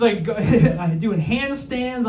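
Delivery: usual speaking voice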